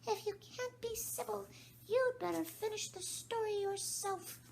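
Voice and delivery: very high sulky squeak; an offended mouse; sulky Dormouse. Acted. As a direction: sulk it out as the Dormouse. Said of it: "the Dormouse sulkily remarked".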